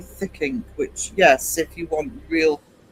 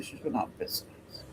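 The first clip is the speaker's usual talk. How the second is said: whispered, mock grave; hushed aside; 7 semitones lower, 15 dB quieter than usual. Telling the chat about Lisa's biscuits, a mock-grave whisper. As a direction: breathe it like sharing bad news.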